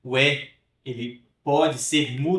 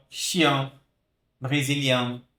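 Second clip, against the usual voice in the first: usual speaking voice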